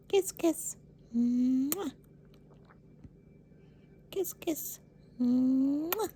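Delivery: high kissy sing-song; pet kiss talk. Real, not acted. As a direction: coo kisses at the bird. Kissing noises for the bird.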